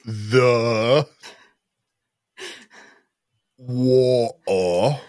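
laboured attempt at English accent; deep grog accent. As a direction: drag out each word in a botched English accent. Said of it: "deep grog accent".

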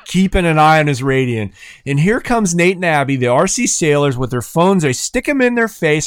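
ordinary delivery

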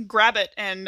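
ordinary talk

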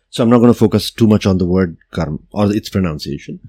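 ordinary talk

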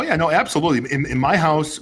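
ordinary talk

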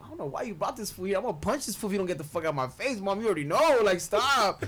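put-on protesting kid. Kid begs mom to stop the clown, angry protest. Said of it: "And I was like".

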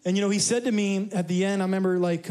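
ordinary talk

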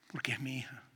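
whispered, sincere; tender whisper. Real, not acted. Preacher whispers why he hugged his dirty daughter. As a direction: whisper it with love. Sermon climax, tender point.

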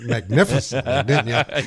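laughing through the word. Real, not acted. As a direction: chuckle while saying it. Teasing about shower singing.

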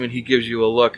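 usual speaking voice

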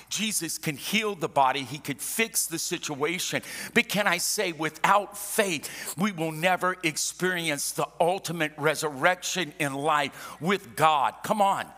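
high, loud sermon at full force; impassioned preaching. Real, not acted. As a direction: rouse the congregation, punching the key words.

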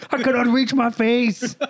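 mock strongman lament; Jorgen von Strangle. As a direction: imitate a straining musclebound hero. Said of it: "And Jorgen von Strangle".

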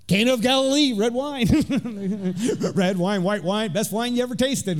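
mock street seller hyping his wine; wine seller pitch. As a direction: sell it like a market stall vendor. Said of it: "according to the guy at the stand there".

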